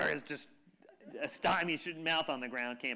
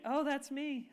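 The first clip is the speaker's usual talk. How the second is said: coy grandma; 9 semitones higher, slower than usual. Retold or imitated. Grandma answering the compliment.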